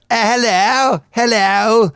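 high, drawn-out mock greeting; comic airman catchphrase. Airman in the amateur play greeting the audience, high comic voice. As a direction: ham it up like a sitcom airman popping on stage. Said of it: "come out now and again and go".

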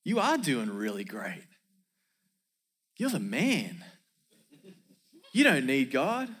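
slinky flatterer with swooping pitch; voice of pride. Acted. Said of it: "that voice talks like this".

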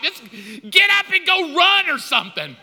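high, loud comic blow-up; exasperated outburst. Retold or imitated. Husband out of patience after four minutes, exasperated shout. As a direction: burst out as if your head will explode. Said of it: "my head is gonna blow up".

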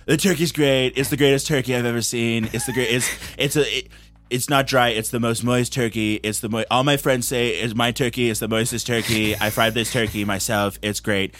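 mock-presidential bragging, sliding toward Brooklyn; Donald Trump. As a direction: ramble in repeated superlatives. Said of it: "it's Donald Trump".

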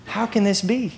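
softly quoted question; Mary. Retold or imitated. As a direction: ask it quietly, in your own register. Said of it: "She says".

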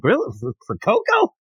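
high, incredulous questioning; baffled disbelief. Acted. Lloyd cannot understand the fuss over cocoa, baffled. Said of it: "he doesn't understand it".

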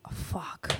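unvoiced whispered fuck; a whispered swear at a pitch too good to refuse; whispered curse. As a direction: breathe it under the breath, defeated. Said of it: "and I'm like".

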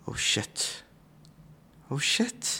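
unvoiced, hushed muttered curse; muttering to himself. Friend realizing it's true, muttering to himself. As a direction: say it under your breath, away from the phone. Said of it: "han snakker litt for seg selv".

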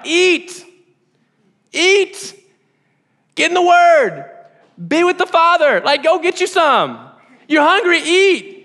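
rally them to eat the Word; fired-up pulpit exhortation; emphatic urging. Real, not acted. Urging congregation to feed spiritually.

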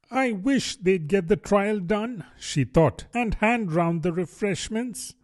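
a hint of a hungry little girl; Alice thinking. Alice's thoughts voiced.

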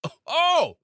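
oh oh burst out high and loud; sudden realization. Real, not acted. Host caught saying the code word, surprised. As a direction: catch yourself suddenly realizing.

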